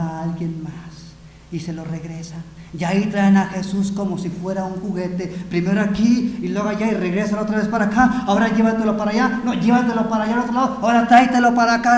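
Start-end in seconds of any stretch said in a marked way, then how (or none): 2.8-12.0 fast, loud, raised-pitch preaching; rapid-fire loud sermon delivery; agitated preaching. Acted. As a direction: rattle off the back-and-forth at full volume. Preacher acts out the shuttling.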